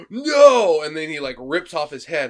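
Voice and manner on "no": mock villain's loud, high cry of no; a big cartoon scream of no; hammed-up shout. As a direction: yell it like a beaten demon. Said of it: "demon priest's like".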